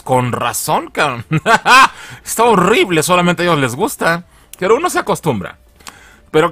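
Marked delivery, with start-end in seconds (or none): 1.3-2.2 high burst of real laughter; laughing at a joke about the voice, loud and high. Real, not acted. Laughs at dubbing remark.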